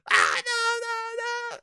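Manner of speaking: very high crying shout of no; hammed-up despairing scream; mock anguished yell. Master Chief yells as the Halo ring falls, mock crying shout. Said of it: "he yells out".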